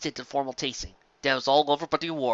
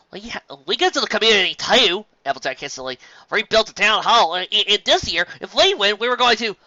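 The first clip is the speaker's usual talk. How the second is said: higher, louder, rushed and flustered; hasty Applejack; 7 semitones higher, 5 dB louder than usual. Acted. Applejack hastily cutting in to Fancy Pants, flustered. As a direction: jump in before you're forgotten.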